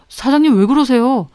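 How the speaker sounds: ordinary delivery, nothing marked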